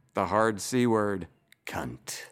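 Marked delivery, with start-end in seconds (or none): 1.7-2.2 very low, voiceless whisper; hushed whisper. Real, not acted. Naming the hard C word, whispered.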